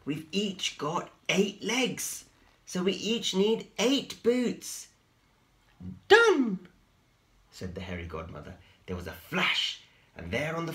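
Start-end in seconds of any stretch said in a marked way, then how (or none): 0.0-4.9 slow, high and careful; slow high Spinderella. A narrator's reading. Spinderella explains the boots, high and slow. 6.1-6.7 very high, loud fairy voice; high magical godmother. A narrator's reading. The hairy godmother appears, very high and loud.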